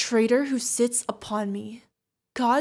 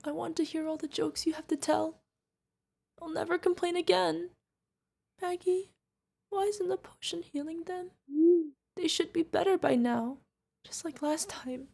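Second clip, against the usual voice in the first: soft, high, fragile voice; tearful dread; 5 semitones higher, 5 dB quieter than usual. Acted. Guardian watches owlet not healing, quiet distress. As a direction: plead quietly, pitched up and half whispered. Fears the kid is dying.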